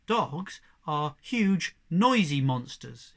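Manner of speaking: slow, loud and wide-eyed; awed. A narrator's reading. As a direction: drag out the scary words. Young cat explains dogs, awed.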